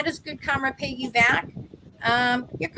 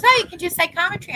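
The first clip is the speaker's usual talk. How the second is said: bright, quick, louder delighted reveal; excited announcement; 8 semitones higher, 6 dB louder, faster than usual. Retold or imitated. English psychic excitedly tells the speaker her gift. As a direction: tell her eagerly what her gift is. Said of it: "And she goes".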